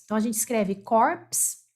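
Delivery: ordinary talk, nothing marked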